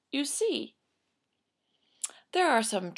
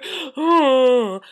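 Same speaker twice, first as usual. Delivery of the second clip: loud wordless boo-hoo; hammed-up sobbing howl; mock crying wail; 4 semitones higher, 10 dB louder than usual. Parents crying with their daughter, mock wail. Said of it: "they started crying too".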